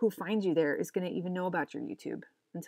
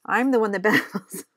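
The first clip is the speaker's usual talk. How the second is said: ordinary talk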